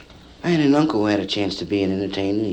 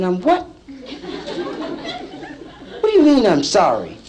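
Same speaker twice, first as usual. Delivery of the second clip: indignant disbelief. Retold or imitated. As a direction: act offended, pitch up high. Lulu indignant at apology.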